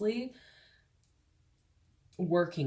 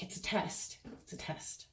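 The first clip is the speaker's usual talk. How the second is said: whisper; 8 dB quieter than usual. Acted. Spirit voice whispering a warning.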